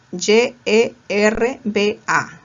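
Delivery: Y E R B A, each letter said slowly and separately; spelled out slowly. Shown as a demonstration. Teacher spelling yerba for students, slowly. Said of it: "que también se puede escribir con Y".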